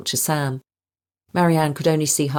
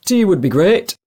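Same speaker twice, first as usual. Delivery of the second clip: put-on loud Lancashire accent, gruff; northern English accent; 5 semitones higher, 8 dB louder than usual. A narrator's reading. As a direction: speak with a strong Lancashire accent. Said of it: "He had a strong northern accent".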